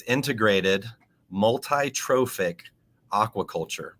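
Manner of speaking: measured, with a pause after each term; slow and deliberate. Shown as a demonstration. Guest spelling out a tough term for listeners, slowly. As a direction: say it slowly, phrase by phrase. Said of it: "say it slowly".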